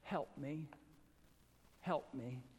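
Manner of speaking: soft pleading cry. Retold or imitated. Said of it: "cries out in front of God".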